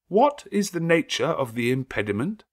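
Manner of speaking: the priest refusing, unsettled; hesitant clergyman. A narrator's reading. Clergyman asks.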